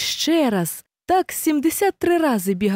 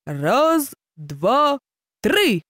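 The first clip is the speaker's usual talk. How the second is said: higher starting count, called out; 3 semitones higher, much slower than usual. A narrator's reading. Hare calls the start of the race. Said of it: "вигукнув заєць".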